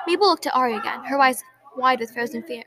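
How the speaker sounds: usual speaking voice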